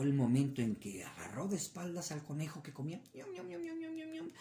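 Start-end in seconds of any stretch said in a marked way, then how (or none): 3.1-4.3 high, soft nibbling bunny; munching rabbit. Acted. Rabbit still eating, high munching voice. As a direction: chew happily, unaware.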